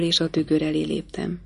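ordinary delivery

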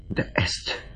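breathless whispered start; stunned whisper. A narrator's reading. Betrayed countess faces her sister in a whisper. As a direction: barely get the words out.

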